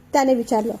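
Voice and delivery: ordinary delivery, nothing marked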